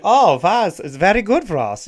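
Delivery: high, loud, hammed-up Swedish accent; mock Swedish accent. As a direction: ham up a silly Swedish accent for the Nova Scotia folks. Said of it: "I was gonna start doing a Swedish voice".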